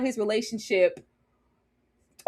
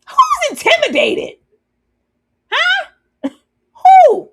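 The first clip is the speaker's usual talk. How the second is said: squeaky high protest, loud; mock denial; 9 dB louder, slower than usual. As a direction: feign offense in a high squeak.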